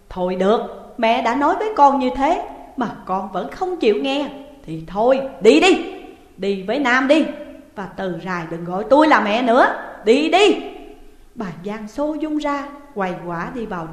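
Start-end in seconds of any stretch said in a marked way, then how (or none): 0.1-10.6 loud, rising angry shout; furious mother. A narrator's reading. Mother throwing her daughter out, furious shouting. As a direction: drive her daughter out of the house. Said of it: "Bà Giang giận dỗi".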